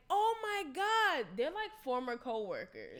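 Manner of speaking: put-on African accent. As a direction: stretch out an African accent in delight. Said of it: "the accent that you're trying to do".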